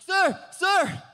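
high loud call after him. Retold or imitated. Driver calling after the truck owner, loud high call. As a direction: shout to stop the man walking away.

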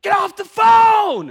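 high, furious yell down the line; angry shout. Retold or imitated. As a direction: roar it like a woken mother. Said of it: "she hears".

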